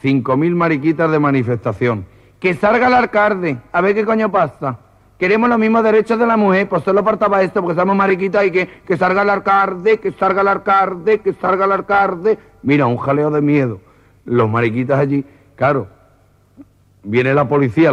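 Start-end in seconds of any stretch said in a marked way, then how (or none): 2.4-12.4 high-pitched demonstrator's demands; mock protest in a raised voice; acted protester. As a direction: play an indignant protester, voice lifted. Demonstrators demanding the mayor come out.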